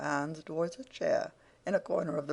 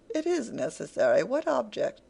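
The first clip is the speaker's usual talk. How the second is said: mild anger, the question rising higher; wrathful demand; 7 semitones higher, 4 dB louder than usual. A narrator's reading. Venus pressing Wegg about the object, mildly wrathful. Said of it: "demanded Venus in a wrathful tone".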